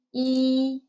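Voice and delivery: E held long on a level tone; final sound E. Shown as a demonstration. Teacher isolates the final of 你 for learners, sounded out. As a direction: sound out E alone, steady like a sung note. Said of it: "The final is".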